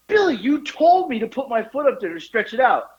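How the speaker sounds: high, pleading mock outrage; retold indignant protest. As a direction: act out blaming your friend. Retold accusation at Billy.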